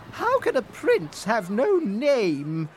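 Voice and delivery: indignant queen. Acted. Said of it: "asked the queen".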